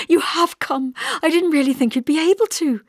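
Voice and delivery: put-on high, excited young woman; bubbling over with disbelief and joy; eager Hester. A narrator's reading.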